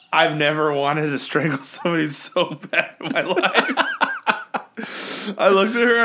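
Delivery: slow, high words through laughter; laughing while speaking. Real, not acted. Laughing while recalling frustration.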